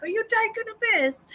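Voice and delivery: high, put-on British pub voice; mock British accent. Retelling the Kingsman pub scene in mock British accent. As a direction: play the Kingsman bloke in a cartoon British accent. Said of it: "the guy comes out of the pub and he's like".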